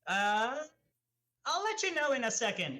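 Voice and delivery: high, loud, quick brush-off; stall, then rattle off the answer high; high-pitched evasive customer; high character voice. Acted. Player answers barkeeper as Vivi.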